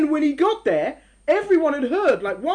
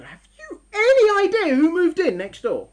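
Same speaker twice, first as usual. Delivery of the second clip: louder, slower, wide-eyed question; alarmed news to spouse; 8 semitones higher, 7 dB louder, slower than usual. Acted. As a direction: burst in with shocking news. Telling spouse about new neighbours.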